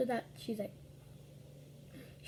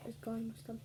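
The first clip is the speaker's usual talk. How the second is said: whispered aside; 8 dB quieter, faster than usual. Real, not acted. Aside while eating.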